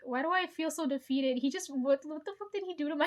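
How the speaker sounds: normal talking voice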